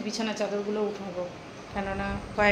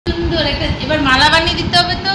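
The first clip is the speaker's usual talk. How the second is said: put-on baby talk; 9 semitones higher, 14 dB louder than usual. Cooing while dressing up Apu.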